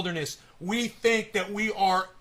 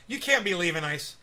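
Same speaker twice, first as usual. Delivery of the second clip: ordinary delivery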